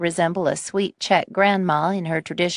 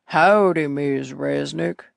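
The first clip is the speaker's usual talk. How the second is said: Texan drawl; 3 semitones lower, slower than usual. Acted. Texan rancher voiced.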